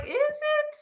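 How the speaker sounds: very high, doubtful squeak; suddenly unsure, up high; stunned question. Retold or imitated. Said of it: "And I was just like".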